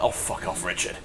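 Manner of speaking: whispered, under the breath; muttered dismissal. Retold or imitated. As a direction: breathe it out like a private aside. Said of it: "I was like".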